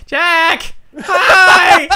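high excited call. Real, not acted. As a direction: yell to get his attention. Calling out across room.

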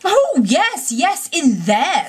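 very high, drawn-out impatient assent; exasperated Toad, stretching the words. A narrator's reading. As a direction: brush the accusation off with a swooping high voice. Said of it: "said Toad impatiently".

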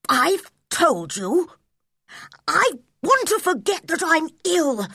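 put-on high, haughty young prince; imperious prince's voice. A narrator's reading. Boy tells doctor off, imperious prince's voice. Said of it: "in his prince's voice".

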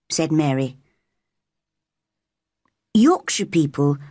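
usual speaking voice

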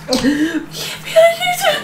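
very high, drawn-out tearful wail; crying in panic. Acted. Mother losing her composure adrift, crying panic. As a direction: dissolve into hammed-up sobs. Breaks down while saying it.

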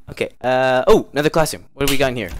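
usual speaking voice

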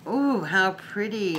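normal talking voice

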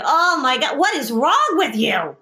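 mock a fed-up husband; husband losing patience with wife, exasperated. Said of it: "he would say".

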